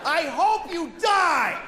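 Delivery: high shout, slow; road rage shout. Retold or imitated. Driver shouting at another driver, high angry shout. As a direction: scream it through the windshield. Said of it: "I said that to a person".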